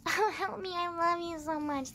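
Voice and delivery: high, breathy-sounding mock of a helpless girl; mock swooning heroine, high; mocking damsel voice. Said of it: "the girls is always like".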